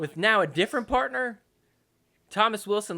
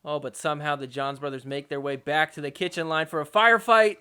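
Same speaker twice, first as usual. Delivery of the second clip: usual speaking voice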